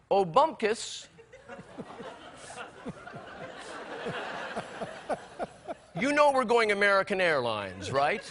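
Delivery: a hint of the wife, breezy and laughing; imitating Nancy. Husband imitating his wife chatting to the driver. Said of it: "Nancy says to the driver".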